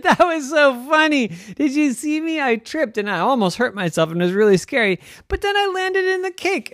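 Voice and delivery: high, gleeful rush; giddy. A narrator's reading. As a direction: tell it giddily, thrilled by your own fall. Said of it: "she started laughing".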